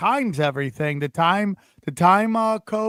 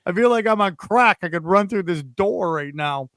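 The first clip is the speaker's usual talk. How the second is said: ordinary talk, nothing marked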